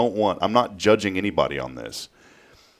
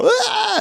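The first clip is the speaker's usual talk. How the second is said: put-on short high-pitched kid scream; mock scream. Dad imitates another kid on the ride, high mock scream. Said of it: "just screaming when they were".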